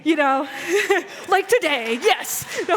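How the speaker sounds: tickled, laughing while telling the congregation. Real, not acted. Laughing at her own old outfit.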